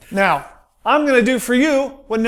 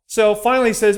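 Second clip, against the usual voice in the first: usual speaking voice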